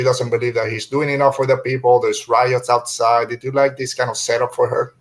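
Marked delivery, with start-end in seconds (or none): none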